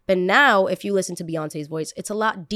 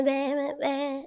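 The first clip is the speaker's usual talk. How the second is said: put-on baby voice demo; 7 semitones higher, slower than usual. Shown as a demonstration. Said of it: "It's not the".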